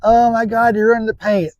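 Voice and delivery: high, gasping mock alarm from viewers; lightly hammed-up shocked viewer; mocking the commenters. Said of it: "for everybody saying".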